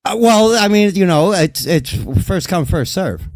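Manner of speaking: tipsy. Real, not acted. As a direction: talk like you're a bit tipsy. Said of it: "I'm a little tipsy".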